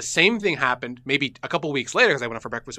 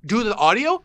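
mock shock; 9 semitones higher, slower than usual. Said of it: "And I go".

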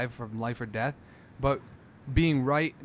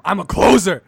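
mock yell; 6 semitones higher, 11 dB louder than usual. Quoting the Baldwin speech.

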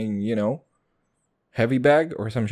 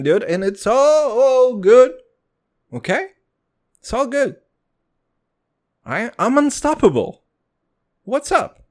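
hyped-up boasting; 10 semitones higher, 6 dB louder than usual. Real, not acted. Hyped boasting after accent bit.

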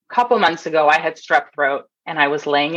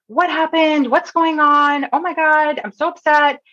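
high, frantic imitation of an upset mother; mock-distressed high voice; distressed woman; 9 semitones higher, slower than usual. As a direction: mimic a panicky mother. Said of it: "this person looks really distressed".

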